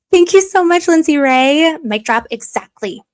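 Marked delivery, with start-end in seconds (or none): 0.1-1.8 bright, loud burst of thanks; excited thanks. Real, not acted. Streamer gushing thanks to a viewer, loudly excited. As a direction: gush your thanks, loud and high.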